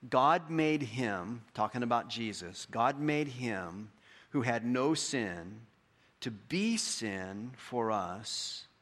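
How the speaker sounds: very slow, deliberate verse; slow emphatic reading. Shown as a demonstration. Preacher slowly reading verse to memorize, emphatic. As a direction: weigh each phrase as you read. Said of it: "reads key verse slowly".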